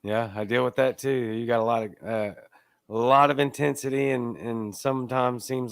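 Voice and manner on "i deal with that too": usual speaking voice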